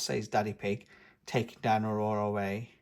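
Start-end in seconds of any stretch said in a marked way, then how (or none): none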